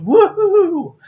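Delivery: high, loud whoop of joy; excited cheer. Real, not acted. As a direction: let out a big happy woohoo. Cheering over a standard ink.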